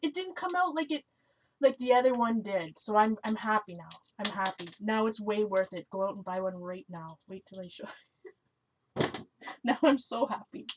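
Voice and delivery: ordinary delivery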